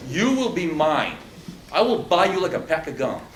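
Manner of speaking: loud, hard threat from an acted prison bully; menacing inmate threat. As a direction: lean in and threaten him like a cell-block predator. Playing a prison bully at listener.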